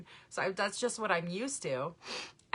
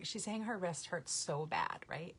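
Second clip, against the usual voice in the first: ordinary talk, nothing marked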